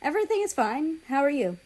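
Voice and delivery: lightly voiced polite girl. A narrator's reading. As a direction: answer politely. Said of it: "Ukraine asked politely".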